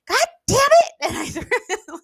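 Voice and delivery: retold angry outburst, pitched very high; mad outburst. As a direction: snap it out in sudden frustration. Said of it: "I was so mad".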